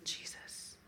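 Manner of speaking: whispered name, barely voiced. Shown as a demonstration. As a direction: breathe the name out softly. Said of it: "Oh, I felt a little something".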